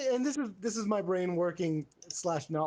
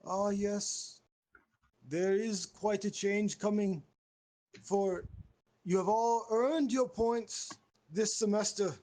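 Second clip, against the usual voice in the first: slow, ceremonious announcement pitched up; grand pompous master; 3 semitones higher, slower than usual. Acted. Host revealing the final scores, grand and ceremonious. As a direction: pronounce the results with stately pauses.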